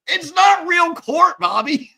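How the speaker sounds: loud, high, slow exclamation; laughing shouted protest. Real, not acted. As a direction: belt it out at Bobby.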